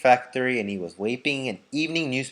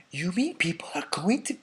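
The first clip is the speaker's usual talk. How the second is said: whispered false start; wrong voice; 7 semitones higher, 4 dB quieter than usual. Acted. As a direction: whisper it before catching the mistake. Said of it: "oh, it's Grandpa Joe".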